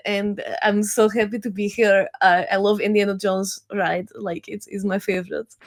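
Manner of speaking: lightly put-on Russian accent; slight Russian accent. Shown as a demonstration. Telling Disneyland cast members where you're from, in a slight Russian accent. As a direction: speak English with a touch of Russian. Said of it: "a slight Russian accent".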